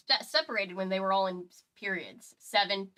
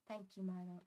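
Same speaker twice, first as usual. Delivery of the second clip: soft attempt at Garfield; 15 dB quieter than usual. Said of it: "I can't really do Andrew Garfield's very specific voice".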